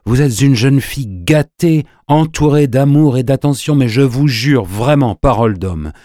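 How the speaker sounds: impassioned, reproachful declaration; earnest reproach. A narrator's reading. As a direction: swear it forcefully, with reproach. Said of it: "comme empli de reproches".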